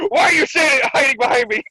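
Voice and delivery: high, loud cartoon outburst; indignant mock shout. Acted indignant shout at companion.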